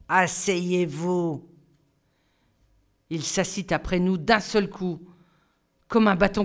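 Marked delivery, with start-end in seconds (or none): 0.1-1.5 slow, cold command from the master; icy teacher order. A narrator's reading. Stern teacher ordering the pupils to sit, slow and icy.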